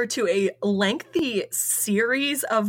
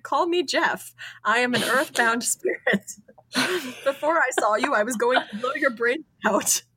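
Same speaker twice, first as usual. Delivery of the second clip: higher, lightly voiced talking mongoose; Jeff; 4 semitones higher than usual. A narrator's reading. Mongoose introduces himself to the investigator, hint of a screechy voice. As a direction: lightly imitate a screechy creature, breaking into a laugh. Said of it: "a screeching voice said".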